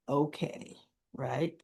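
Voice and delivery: low, soft retold okay; hesitant agreement. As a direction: agree quietly and reluctantly.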